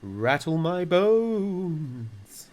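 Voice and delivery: very drawn-out, high ghostly wail; spooky voice. A narrator's reading. Parrot imitates a ghost for the children, long spooky wail. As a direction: stretch it out slowly like a spook, swelling loud. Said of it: "giving a wonderful imitation of a spooky voice".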